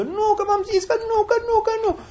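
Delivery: high, nagging mock whine; mocking insistent denial. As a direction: parody someone who keeps repeating no. Mocking stubborn repetition.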